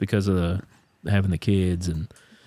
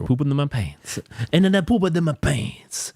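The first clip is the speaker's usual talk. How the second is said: put-on overdone Italian accent telling a sad story; 6 semitones higher than usual. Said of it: "stuck to the Italian accent pretty well".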